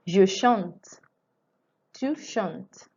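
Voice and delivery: Je chante, Tu chantes said slowly and clearly; teacher recites Je chante, Tu chantes for students; model conjugation. Shown as a demonstration. Teacher to students, clear model conjugation. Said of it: "The verb is chanter".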